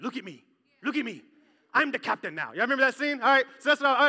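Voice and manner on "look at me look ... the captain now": Captain Phillips pirate line. Retold or imitated. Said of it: "You know when that guy's like".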